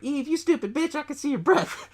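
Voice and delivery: high-pitched retold taunt; mock annoyed callout. Girl calls out to supposed prankster Eve, mock annoyed. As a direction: call it out high-pitched, without raising volume.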